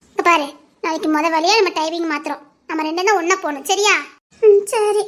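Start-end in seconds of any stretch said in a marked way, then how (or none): 4.4-5.0 crying as she agrees; brief, teary assent; tearful. Acted. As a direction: agree through the tears. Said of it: "அழாம போ".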